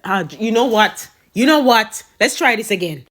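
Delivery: loud burst of frustration; exasperated outburst. Acted. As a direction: lose patience and yell it. Exasperated outburst after bad result.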